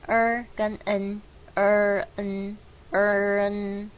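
"er" and "n" pulled apart, very slow; split er and n. Shown as a demonstration. Teacher breaks "learn" into er and n slowly. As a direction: hold er, then add n separately. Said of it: "你就拆成两半".

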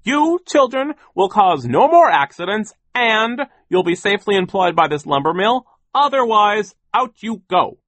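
high, loud, emphatic ultimatum; overbearing boss. A narrator's reading. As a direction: lay down the law at full voice. Sir threatens children.

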